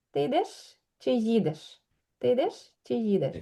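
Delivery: йдеш with a silent first i, stress on e; model pronunciation. Shown as a demonstration. Teacher demonstrating the stress pattern. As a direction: make йдеш almost start on d, then a strong їдеш. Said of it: "el acento cae en la e".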